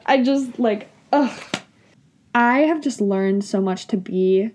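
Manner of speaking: normal talking voice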